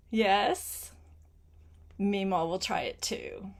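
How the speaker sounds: plain reading voice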